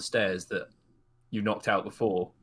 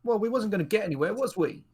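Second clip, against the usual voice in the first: put-on young woman voice; 9 semitones higher than usual.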